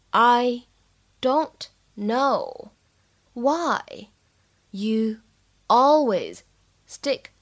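slow, each word set apart. Shown as a demonstration. Teacher models the line for learners, slowly word by word. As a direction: say it word by word with clear pauses.

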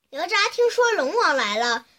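usual speaking voice

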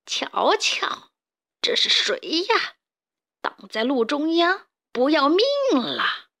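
sneering villain with swooping pitch; insidious, amused menace; sly, sinister boss. Acted. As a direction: taunt your prey, stretching the last words. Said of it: "田鼠普老大阴险地笑着".

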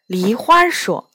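ordinary delivery

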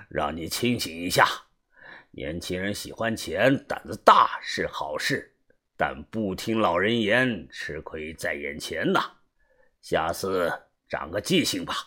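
a wise elder giving a calm warning; old master voice. Acted. Old master Jiao lecturing.

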